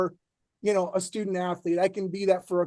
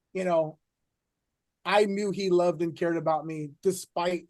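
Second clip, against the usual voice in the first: ordinary talk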